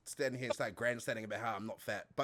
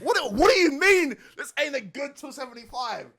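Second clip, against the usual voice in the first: high, loud mock-offended protest; mock outrage; 13 semitones higher, 10 dB louder than usual. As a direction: play up being insulted. Mock offence at weight remark.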